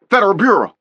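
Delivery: loud commanding shout of armed agents. A narrator's reading. FBI agents ambushing the kidnapper, loud shout. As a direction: bark it out as a police raid.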